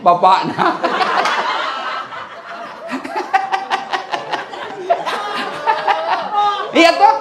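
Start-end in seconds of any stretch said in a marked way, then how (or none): 0.8-6.7 long high laugh. Real, not acted. Audience and speaker laughing.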